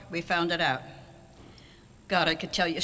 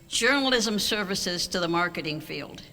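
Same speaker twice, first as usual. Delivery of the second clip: ordinary talk, nothing marked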